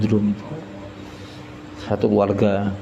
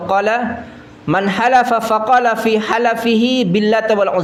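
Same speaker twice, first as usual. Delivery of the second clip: raised-pitch, measured recital of Arabic and its translation; hadith recitation; 8 semitones higher than usual. Acted. Preacher reciting a hadith to the congregation, slow and high. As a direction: recite it high, drawing out the key phrases.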